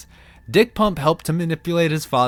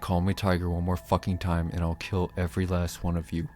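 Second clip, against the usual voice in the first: low menacing threat; 7 semitones lower, 7 dB quieter than usual. Retold or imitated. Lorgar threatens the loyalists.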